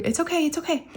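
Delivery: high, gentle reassurance; retold soothing. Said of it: "trying to talk her down".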